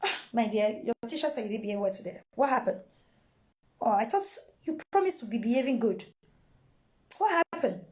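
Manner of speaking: low, soft, quick letdown sigh of a voice; mother feigning sadness over bad report; mock disappointment. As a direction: sound let down but gentle. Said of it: "put on that look of say you not dey happy".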